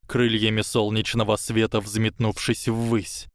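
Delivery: ordinary delivery, nothing marked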